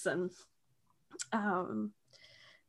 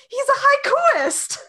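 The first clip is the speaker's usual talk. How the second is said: very high, loud mock outburst; imitating a worked-up character; exasperated retort; 8 dB louder than usual. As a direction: squeal it in hammed-up exasperation. Acts David's exasperated answer.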